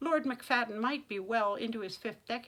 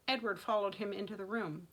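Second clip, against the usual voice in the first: usual speaking voice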